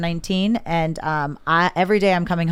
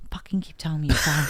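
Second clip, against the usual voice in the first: irritated whisper; 3 semitones lower, 5 dB quieter than usual. Retold or imitated. Mutters back at proud husband.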